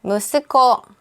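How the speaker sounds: ordinary talk, nothing marked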